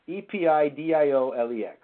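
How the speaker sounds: E P I D I O L E X letter by letter; name each letter of E P I D I O L E X in turn; spelled out. Shown as a demonstration.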